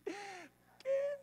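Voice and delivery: put-on high whiny spoiled-kid voice; spoiled child whining to God; spoiled child whine. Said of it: "criança mimada fica fazendo".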